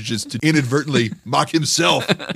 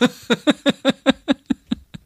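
high burst of laughter; 14 semitones higher than usual. Real, not acted. Host laughing at his own brother story, high laugh.

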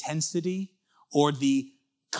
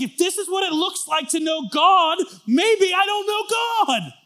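doubting inner voice; 9 dB louder than usual. Retold or imitated. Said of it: "And you start to think".

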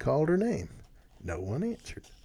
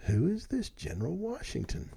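higher-pitched, puzzled question; mildly mystified; wondering; 7 semitones higher, 4 dB quieter than usual. A narrator's reading. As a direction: wonder aloud, pitch climbing. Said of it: "Rip wondered".

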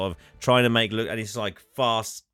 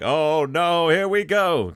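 higher, louder comic dismay; mock dread; 6 semitones higher, 3 dB louder, slower than usual. Tram driver resigned to the crash, higher comic dismay. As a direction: brace for the crash in a hammed-up whine. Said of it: "He's like".

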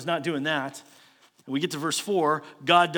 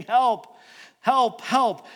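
loud, pleading calls of help; desperate cry for help; 6 semitones higher, 6 dB louder, much slower than usual. Retold or imitated. Preacher voicing panicked sailors, loud desperate cries. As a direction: cry out like sailors about to drown. Sailors crying to their gods.

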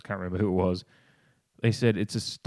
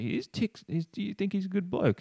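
much higher-pitched mock questioning; retold questioner; 12 semitones higher than usual. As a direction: imitate a pestering question in a high voice. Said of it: "he just wanted Sloane to say".

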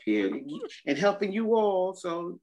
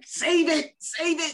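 excited high plea; 9 semitones higher, 4 dB louder than usual. Retold or imitated. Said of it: "Dominican friends who are like".

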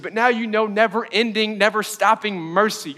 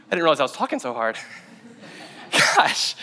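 ordinary talk, nothing marked

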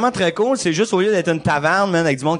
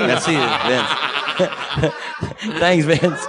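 laughing; much slower than usual. Real, not acted. Thanking Vince through laughter.

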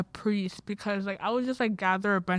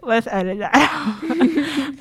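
laughing; 6 semitones higher, 8 dB louder, slower than usual. Real, not acted. Embarrassed after slip.

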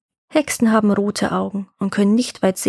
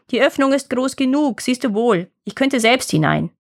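lightly voiced witch, raised at first; impatient witch; 3 semitones higher than usual. A narrator's reading. Witch shows Gretel the oven opening, impatient. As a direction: show her up as silly.

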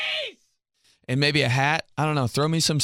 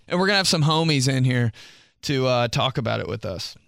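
ordinary talk

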